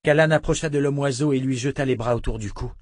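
ordinary delivery, nothing marked

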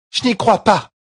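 high, loud disbelieving cry; Jolene's outcry. A narrator's reading. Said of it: "s'écria Jolene".

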